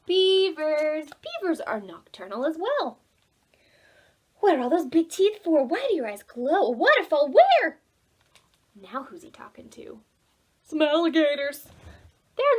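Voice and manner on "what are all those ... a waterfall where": louder, rising to a high where; alarmed by the news; eager young Oliver. Acted. Oliver questioning the alligators, alarmed.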